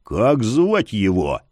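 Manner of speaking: slow low growl; holding back a shout. Acted. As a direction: press him, growling low. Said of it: "прорычал Яр".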